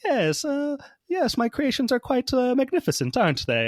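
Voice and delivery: high-pitched, smugly proud scientist impression; smug doctor. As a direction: play a doctor very pleased with her own work. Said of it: "like, smug doctor, like, very proud of her work".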